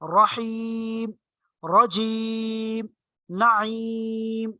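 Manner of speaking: रहीम, रजीम, नईम each with the ई held very long; recitation-style lengthening of रहीम, रजीम, नईम; stretched long ī. Shown as a demonstration. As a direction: draw out the ī of every word slowly. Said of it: "उसको खींच के पढ़ेंगे".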